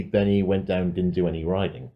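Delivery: normal talking voice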